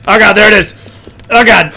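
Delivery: startled loud cry at the monster; scared yelp. Real, not acted. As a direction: yell out in sudden fright. Monster suddenly appears.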